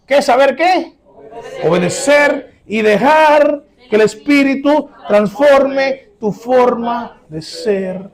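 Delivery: loud, high and drawn out; preaching at full force. Acted. Pastor thundering the answer at his congregation, loud and slow. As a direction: hammer the answer home to the church, slow.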